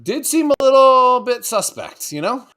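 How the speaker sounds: joking high-voiced suspicion; mock suspicion. Jokey put-on insinuation about Bambi.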